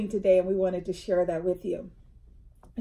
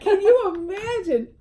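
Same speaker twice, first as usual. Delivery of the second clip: high, loud exclamation; incredulous, pitch way up; amused disbelief; 10 semitones higher, 6 dB louder than usual. Real, not acted. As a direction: exclaim it in real astonishment.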